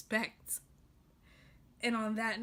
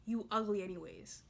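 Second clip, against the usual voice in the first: mock cursing out; 7 dB louder, slower than usual. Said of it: "curse them out and be like".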